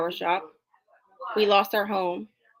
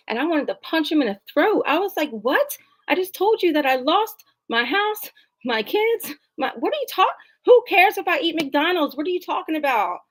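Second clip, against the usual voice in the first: mock fury at a clueless doctor; exasperated outrage; 7 semitones higher than usual. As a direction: let the outrage spill over.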